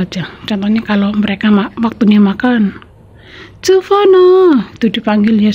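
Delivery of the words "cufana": high, long drawn-out call across the house. Retold or imitated. Employer calling the child to dinner, a high drawn-out call. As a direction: call the child's name to come eat. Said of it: "sahabat teriak-teriak ya".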